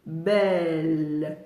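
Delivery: "Belle" low with a trailing long L; syllable and a half. Shown as a demonstration. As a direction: draw out "Belle" into a held L. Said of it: "C'est une syllabe et demie".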